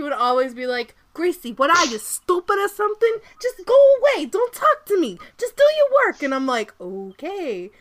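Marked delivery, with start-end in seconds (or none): 1.1-6.2 loud, high, hammed-up Boston Italian boss; Boston Italian accent. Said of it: "epitome of the Boston Italian stereotype". 6.8-7.7 low, softer drawn-out okay; meek reply. Retold or imitated. Worker answering the scolding boss, low and meek.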